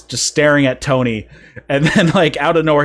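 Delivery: ordinary delivery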